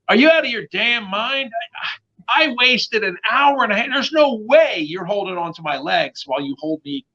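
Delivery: high-pitched indignant disbelief; mock-outraged refusal; going off. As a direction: retell an incredulous outburst, voice shooting up. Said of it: "I went off".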